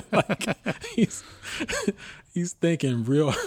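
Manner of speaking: high, laughing through words; giggling while teasing. Real, not acted. Laughing at thinking friend.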